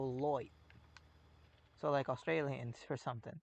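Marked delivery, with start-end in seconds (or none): 0.0-0.5 "loit" said with an oi vowel, light as loit; Aussie-style loit instead of light; Australian "light". Shown as a demonstration. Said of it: "they go loit".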